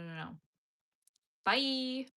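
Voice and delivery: ordinary talk, nothing marked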